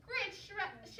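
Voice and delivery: usual speaking voice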